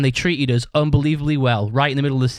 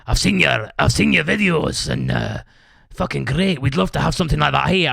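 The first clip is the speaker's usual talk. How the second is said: put-on Scottish promoter voice; Scottish accent. Promoter praises the band and wants a booking, mock Scottish accent. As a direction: lay on an imitated Scottish accent. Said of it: "do you like my Scottish accent".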